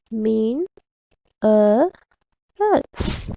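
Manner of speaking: MINERALS in three separate beats, "Mean, a, else"; syllable by syllable. Shown as a demonstration. Teacher counting syllables for kids, syllable by syllable. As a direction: pause after each part of "Mean, a, else". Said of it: "How many syllables does this word has".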